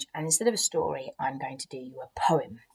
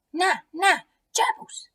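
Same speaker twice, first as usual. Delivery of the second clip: put-on high, squeaky toddler refusal; insistent two-year-old; 5 dB louder, slower than usual. Eddie shaking his head at dad, stubborn toddler voice. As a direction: contradict like a stubborn little child. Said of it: "he shakes his head and he goes".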